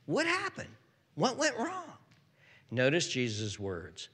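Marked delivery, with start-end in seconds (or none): 0.1-2.0 high, soft, puzzled voice; baffled questioning. Acted. Disciples privately asking Jesus about their failure, baffled. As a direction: ask in mock bewilderment, thin and high.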